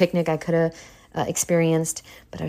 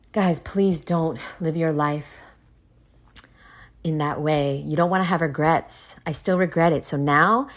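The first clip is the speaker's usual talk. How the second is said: ordinary talk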